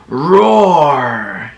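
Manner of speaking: playful growled Roar; mock roar for children; tiger roar. Shown as a demonstration. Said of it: "Tigers say".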